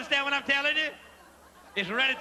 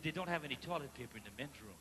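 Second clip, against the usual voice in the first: embarrassed hush; 10 semitones lower, 14 dB quieter, faster than usual. Retold or imitated. Whispering embarrassed request to cashier.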